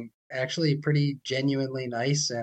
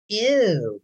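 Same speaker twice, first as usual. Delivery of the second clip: high, louder squeamish "ew"; mock disgust; 8 semitones higher, 6 dB louder than usual. Mocking a squeamish traveler at unwashed food, exaggerated disgust. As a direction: imitate a squeamish recoil, pitch jumping up. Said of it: "some sort of weird".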